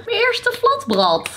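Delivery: proud excitement. Real, not acted. Proud of first flatbread.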